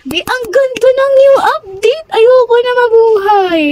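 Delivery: high, wailing squeal of delight; overwhelmed gushing. Real, not acted. Gushing to friends over a new game update, overwhelmed.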